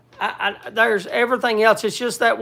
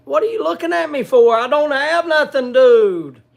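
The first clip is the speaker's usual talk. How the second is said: raised, higher-pitched scolding at the dog; exasperated scolding; 5 semitones higher, 5 dB louder than usual. Real, not acted. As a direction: tell the begging dog off, louder and higher. Scolding dog begging for food.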